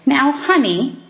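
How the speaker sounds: high, sugary sweet teacher voice; mock-sweet high honey; real sweet. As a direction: coo it falsely sweet, like a trap. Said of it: "real sweet".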